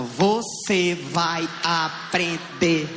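very slow, each syllable hit; mock mother scolding in syllables. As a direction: spell out every syllable as you spank. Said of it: "disse assim, ó".

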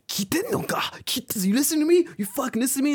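very high, strained demand to be heard; high, thin mock outrage; mock screaming. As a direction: imitate an irate salaryman. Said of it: "screaming, he's like".

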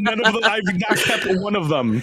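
laughing through a mock confession; teasing, laughing. Teasing her friend about his iPads, laughing. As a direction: tease him, giggling through it.

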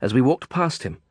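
ordinary delivery

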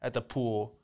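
normal talking voice

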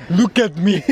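lightly voiced big deep voice; mock big guy to the magpie; gentle giant voice. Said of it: "that big, deep voice".

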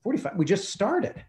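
retold disbelief, pitched well up; incredulous protest. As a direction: react in mild, high-pitched shock. Reacts to sudden time limit.

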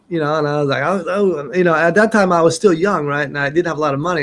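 ordinary talk

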